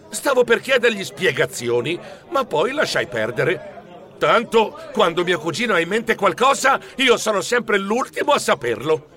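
plain reading voice

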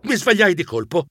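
usual speaking voice

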